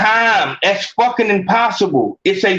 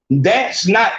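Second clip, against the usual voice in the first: usual speaking voice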